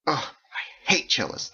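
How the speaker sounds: slow, emphatic grumble of distaste; disgusted. A narrator's reading. Roommate gripes about cellists, disgusted.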